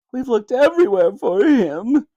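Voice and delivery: high, tearful sniffling voice; a frightened father holding back tears; sniffling dad. Acted.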